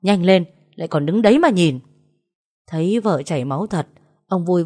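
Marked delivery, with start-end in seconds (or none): 0.0-1.8 louder, impatient order; sharp narrated telling-off; scolding shout. A narrator's reading. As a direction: scold him to hurry up. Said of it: "thì bị bà ngoan quát".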